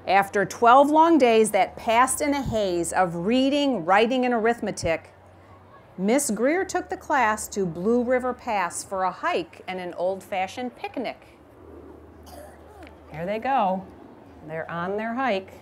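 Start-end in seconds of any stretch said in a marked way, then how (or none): 0.1-11.4 reading a rhyming picture book aloud; gentle storytime reading voice; story narration. A narrator's reading. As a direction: read it like storytime for a class.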